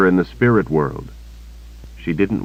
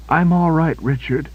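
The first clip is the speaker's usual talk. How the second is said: breathless, high woman's voice, words pushed out between breaths; injured woman panting to Richard that she is fine; 10 semitones higher than usual. A narrator's reading. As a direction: gasp it out, hurt but brave. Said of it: "Kaelin panted".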